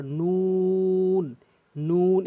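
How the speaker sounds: "নুন" held very long on a level tone; pronunciation demo. Shown as a demonstration. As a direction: stretch the u of "নুন" slowly before the final n. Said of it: "এভাবে উচ্চারণ করবেন". ("নুন" লম্বা করে টেনে)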